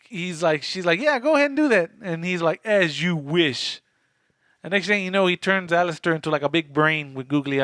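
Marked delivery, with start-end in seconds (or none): 1.0-1.9 mock girl voice. Quoting Mimi dismissively. 2.6-3.8 drawn-out sinister grant; slow menacing as you wish; slow ominous villain. Retold or imitated.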